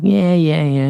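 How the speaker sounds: high, dismissive grandma voice, mock; grandma brushing off. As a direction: wave him off like a half-annoyed grandma. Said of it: "Be like".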